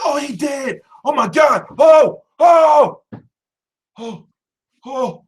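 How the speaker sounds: high, rising panicked oh oh; freaking out as the fighter drops; panicked fan. Retold or imitated. Fan out of his chair, panicking at the knockout.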